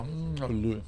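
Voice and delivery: mouth full. Real, not acted. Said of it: "Faz boca cheia".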